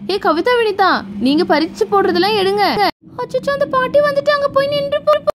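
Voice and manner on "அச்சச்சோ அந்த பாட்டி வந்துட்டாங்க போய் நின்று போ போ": put-on very high child's warning; alarmed little girl. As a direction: squeak a high warning to friends. Child warns that grandma is coming. (பதறும் சின்னப் பொண்ணு குரல்)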